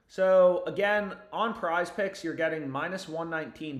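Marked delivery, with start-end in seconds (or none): none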